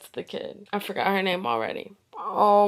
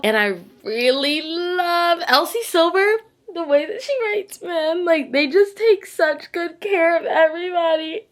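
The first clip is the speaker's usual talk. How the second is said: high-pitched, swooning fangirl gush; 10 semitones higher, 4 dB louder than usual. Real, not acted. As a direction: squeal with love, voice climbing very high. Gushing over a favourite author.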